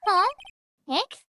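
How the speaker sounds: ordinary talk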